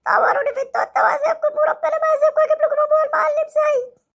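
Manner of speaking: put-on hurt boy threatening the doctor with his dad; sulky hurt child.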